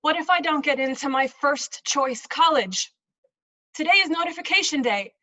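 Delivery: loud, high, fretful monologue voice; anxious parent. Acted. Parent on college notification day, anxious. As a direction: fret aloud about the college decision.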